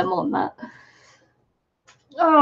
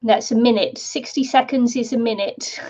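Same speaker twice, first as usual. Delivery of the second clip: normal talking voice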